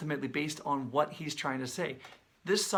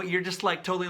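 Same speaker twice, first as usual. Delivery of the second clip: ordinary talk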